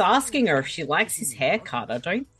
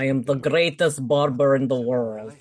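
hammed-up boastful fighter voice; mock-grand fighter's proclamation; Boyka. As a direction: declare it like a solemn action champion. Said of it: "maybe Boyka".

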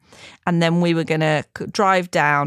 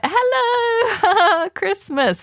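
much higher, forced bright hello through tears; fake cheerful greeting; 13 semitones higher, much slower than usual. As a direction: put on a fake happy face while still teary. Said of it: "fake smiles all round".

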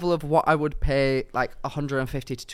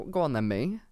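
usual speaking voice

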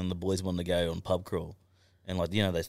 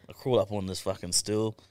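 ordinary talk

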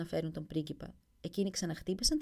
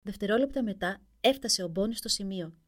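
ordinary delivery, nothing marked

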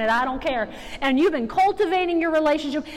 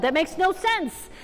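normal talking voice

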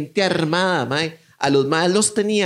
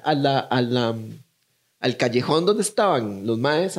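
normal talking voice

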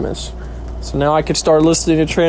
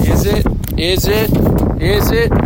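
hopeful suspense; 5 semitones higher than usual. Real, not acted. Hoping for letter in mail.

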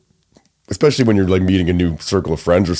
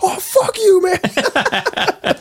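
imitating a much higher-pitched protest; mock outrage; much slower than usual. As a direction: squeal it as the pranked buddy would. Quotes buddy's outburst at a prank.

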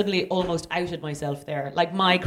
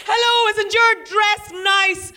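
much higher, slow sing-song greeting; overeager compliment; 11 semitones higher, 4 dB louder, slower than usual. Retold or imitated. To a child in the park, high slow gushing compliment. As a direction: gush at a stranger's kid. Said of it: "or like".

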